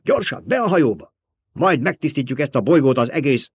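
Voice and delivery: rally them to hurry aboard; urgent command. A narrator's reading. Emperor orders everyone into the ship, urgent command.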